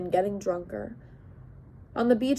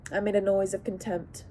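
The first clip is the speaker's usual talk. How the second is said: ordinary delivery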